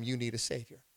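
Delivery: quiet emphasis. Real, not acted. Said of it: "repeated quieter".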